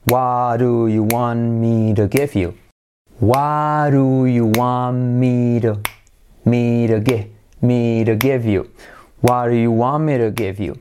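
slow, rhythmic, linked delivery of "What do you want me to give you?"; rhythmic drill. Shown as a demonstration. Teacher drilling students in rhythm, slow and rhythmic. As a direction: drill the question with a steady beat. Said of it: "vamos a hacerlo con ritmo".

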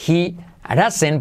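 "He doesn't" linked, D as a tapped R; soft R for D. Shown as a demonstration. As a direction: say "He doesn't" like "hirasen", no T. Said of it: "se convierte en R suave". («He doesn't» con R suave en vez de D)

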